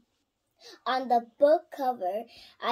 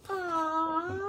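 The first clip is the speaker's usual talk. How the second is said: cooing aww; 4 semitones higher than usual. Real, not acted. Sees picture of kittens.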